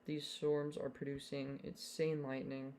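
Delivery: normal talking voice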